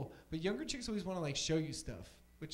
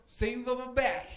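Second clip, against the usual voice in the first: loud, higher-pitched shout from the background; retold call-out; 7 semitones higher, 7 dB louder than usual. Other guy butts into the phone call, calling out loudly.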